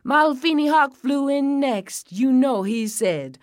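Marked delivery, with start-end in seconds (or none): none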